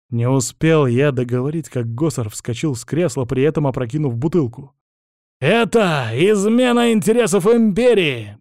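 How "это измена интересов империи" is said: high, loud, slow theatrical outcry; hammy stage actor. Acted. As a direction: declaim it like a melodrama villain. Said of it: "пафосно воскликнул он".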